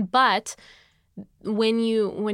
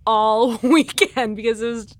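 laughing; 3 semitones higher, 9 dB louder, slower than usual. Real, not acted. Laughing about sobbing at wedding.

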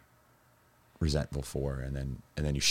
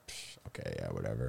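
dismissive brush-off; 10 semitones lower, 11 dB quieter, faster than usual. Retold or imitated. Brushing off the group's warning.

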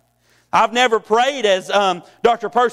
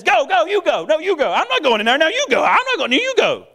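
higher, squabbling cartoon hyenas passing the buck; bickering hyenas; 6 semitones higher than usual. Preacher voices hyenas scared of the thorns, high bickering. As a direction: nervously shove each other forward, no you go. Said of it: "the hyenas look at each other and says".

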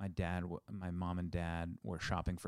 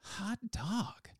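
high-pitched, drawn-out "Hot dog"; pure joy exclamation; 11 semitones higher, 3 dB quieter than usual. Shown as a demonstration. Showing cohost how to use hot dog, pure joy. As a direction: let it rise high with delight, no lead-in. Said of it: "but you say it like this".

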